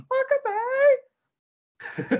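very high-pitched crowd shout; imitated heckler's cry. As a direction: squeal it out like someone in the crowd. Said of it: "there'll even be somebody going".

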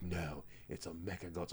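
quiet mock Kiwi accent. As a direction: murmur it like a New Zealand kid. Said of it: "especially in a Kiwi accent as well".

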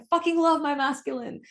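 high, loud joyful outburst; gleeful high exclaim; loud exclamation. Retold or imitated. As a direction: shout it out with delight. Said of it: "I exclaimed it".

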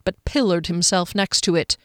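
usual speaking voice

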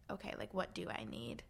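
soft whispered self-question. Retold or imitated. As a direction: ask yourself quietly, almost unvoiced. Quiet inner self-question.

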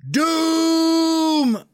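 very high, loud, drawn-out wail; crazy old hag bellow. Shown as a demonstration. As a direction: bellow like a hag in a bad fantasy film. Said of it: "Picture a crazy old hag pointing and bellowing".

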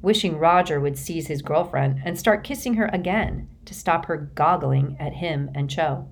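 plain reading voice

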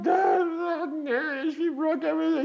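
high, tearful cry to his dad; tearful, high-pitched plea; retold crying. To Dad on the phone after the breakup, crying high. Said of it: "I was crying".

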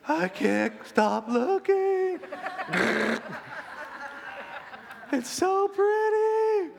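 high, slow, spellbound bug voice with giggles; a bug drawn to the zapper light, slow and high; dazed bug. Retold or imitated. Preacher voicing a bug hypnotized by the light, high and slow. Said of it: "y'all remember Bug's Life?".

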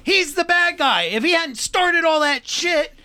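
high, loud heated argument; fired up and insistent; heated rant. Real, not acted.

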